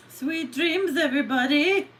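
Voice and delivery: high, playful sing-song; sing-song reading. Acted. Reading a viewer's goodnight aloud, high sing-song. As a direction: lift it up and rise at the end. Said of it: "you do not sound like that".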